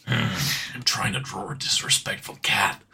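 low, whispered confession; hushed stealth-soldier voice, close to laughing; gruff action-hero parody. Acted. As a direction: rasp it quietly under your breath. Solid Snake meme parody.

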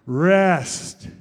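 loud, drawn-out REST; loud group call-out. Shown as a demonstration. Leading the congregation to say the topic word on three, REST loud and drawn out. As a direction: call out REST big and long.

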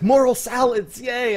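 high excited yay; mock cheer. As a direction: cheer it like a kid. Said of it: "this guy's like".

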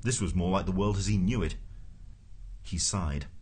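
plain reading voice

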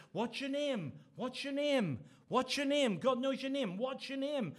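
high, insistent preacher's challenge; calling out to congregation. Retold or imitated. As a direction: fire the question out, imitating a preacher. Preacher retold challenging his congregation.

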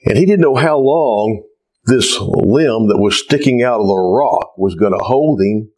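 ordinary talk

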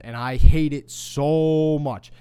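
loud, slow, hammering each word; angry outburst. Real, not acted. Host venting about the player, loud and slow. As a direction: lean in hard on the hate.

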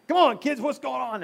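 loud, high dad yell up the stairs; yelling at the kids. Retold or imitated. Dad yelling at his screaming daughters upstairs, loud and high. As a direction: shout come on kids like a tired dad. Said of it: "So I scream at them too".

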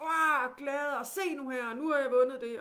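put-on loud, high, gleeful child's cry; loud and high, a child bursting with joy; excited child shouting. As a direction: shout it out like a kid who just won. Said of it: "råber højt".

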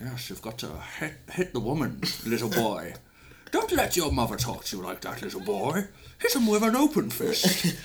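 a hint of a Scottish growl; bad Connery impersonation, rough; Sean Connery impression. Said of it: "the worst Sean Connery impression ever".

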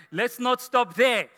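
ordinary talk

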